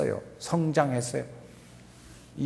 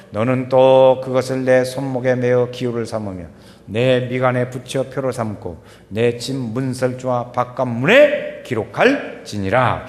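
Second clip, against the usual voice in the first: slow, measured reading aloud on a level tune; scripture reading; 6 dB louder, slower than usual. Real, not acted. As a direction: read the verse out for all to follow. Reads scripture with congregation. (또박또박 느린 성경 낭독)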